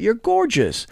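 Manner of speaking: high, cooing compliment; mock girly praise. Mocking girls flattering a friend, high and cooing.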